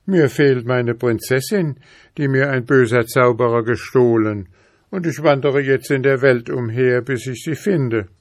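put-on mildly bold young lad. A narrator's reading. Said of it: "sagte er dreist".

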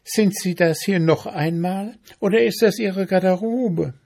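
high, officious ant voice. A narrator's reading. Ant questioning the mayfly, officious.

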